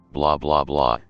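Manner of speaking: ordinary delivery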